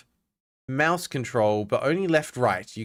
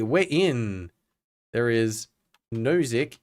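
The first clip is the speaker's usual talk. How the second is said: ordinary talk, nothing marked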